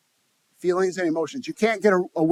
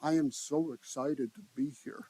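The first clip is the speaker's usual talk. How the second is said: flat, unexcited; 2 semitones lower, 10 dB quieter than usual. Shown as a demonstration. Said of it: "thirty-eight percent is the tonality of your voice".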